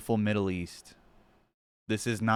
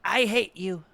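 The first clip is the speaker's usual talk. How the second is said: mock tantrum; 4 semitones higher, 4 dB louder than usual. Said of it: "And he's like".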